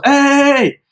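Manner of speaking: high, drawn-out "hey!"; mock yell at moderate volume; imitated teacher's yell. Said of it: "at the front thing yells".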